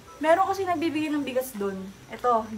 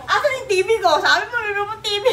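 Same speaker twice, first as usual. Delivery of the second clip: excited laughing demand; 6 semitones higher, 6 dB louder than usual. Real, not acted. Mock demanding her TV gift.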